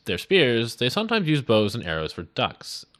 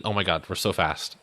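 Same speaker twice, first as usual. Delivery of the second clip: usual speaking voice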